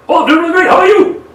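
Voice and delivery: excited fast talker gushing back; chirpy fast reply. Retold or imitated. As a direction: gush back fast and bright. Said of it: "a disconnect that way too".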